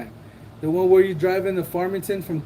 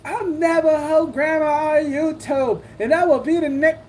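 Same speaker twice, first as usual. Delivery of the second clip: high, put-on mocking voice of a self-styled grandma; mock impression; 10 semitones higher than usual. Streamer mocking a YouTuber's intro, high mocking voice. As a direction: ham up a YouTuber you think is ridiculous.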